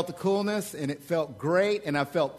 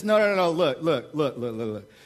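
louder and higher, a know-it-all lecturing; lightly voiced smug skeptic, raised and pushy; voice of the culture; 5 semitones higher, 5 dB louder than usual. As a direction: preach it as the smug modern world talking. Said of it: "A lot of our culture says".

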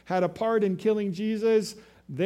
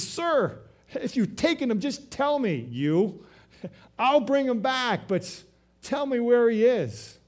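pleading Mary; 6 semitones higher than usual. Acted.

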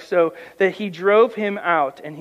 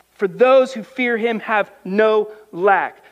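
slower, raised preaching voice; 4 semitones higher, 4 dB louder, slower than usual. Acted. Preacher exhorting his congregation, slow and raised. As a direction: lay each word down slowly.

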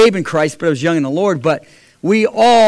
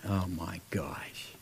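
slow, much lower whispered gasp; awed whisper; 11 semitones lower, 21 dB quieter, slower than usual. Real, not acted. Speaker struck by the devotional, whispering in awe. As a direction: breathe it out, stunned. Convicted reading the daily bread.

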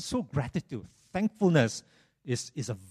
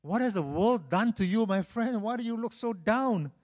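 higher-pitched, puzzled questioning; concerned friend; 8 semitones higher than usual. Acted. Friend asks his downcast pal what is wrong, concerned. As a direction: ask like a worried old friend, pitched up. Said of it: "this other friend asked him".

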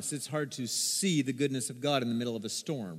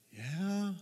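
meek answer; 3 semitones higher, 7 dB quieter than usual. Retold or imitated. Chastened child answering God.

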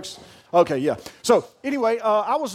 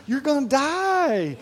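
higher, slow mock-sobbing child voice; mock-tearful child; 6 semitones higher, slower than usual. Daughter tells dad he'll die, mock sobbing. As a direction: wail it like the crying daughter. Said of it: "uncontrollable crying".

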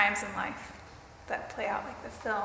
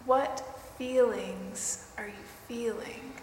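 guided meditation; 3 dB quieter than usual. Acted.

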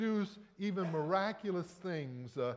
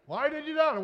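higher, louder "doubt" in a harsher reprimand; rising scold; 10 semitones higher, 5 dB louder, faster than usual. Retold or imitated. Critics scolding Peter, sharper high reproach. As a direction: press the question harder. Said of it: "and say".

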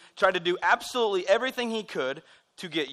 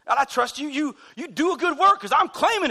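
put-on high-pitched, louder teenage insistence; smack-talking young teen; 9 semitones higher, 5 dB louder than usual. Teen making demands of God, high mock backtalk. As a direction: push it like a mouthy kid. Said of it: "we literally talk smack to God".

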